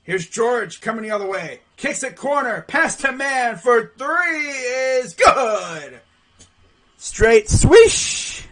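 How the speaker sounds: play-by-play building to a drawn-out shout; live play-by-play. Real, not acted. Commentator calls a made three, building excited play-by-play.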